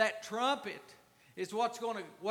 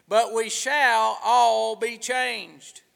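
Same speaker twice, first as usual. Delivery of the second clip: loud, fervent preacher's call to the church; preaching; 11 dB louder than usual. Acted. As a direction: preach it out loud and full of fire. Preaching scripture at full force.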